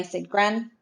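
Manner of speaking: plain reading voice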